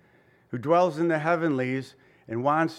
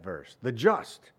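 "the just" slowed and leaned on; stress on the just; 6 semitones lower, 4 dB quieter, slower than usual. Shown as a demonstration. Preacher showing Paul's emphasis, stress on "the just". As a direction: drag out "the just" to show the stress. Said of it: "So he stresses the just part".

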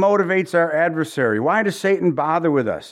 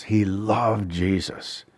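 soft sincere repeat; 8 semitones lower, 4 dB quieter, much slower than usual. Shown as a demonstration. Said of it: "repeated softer, slower".

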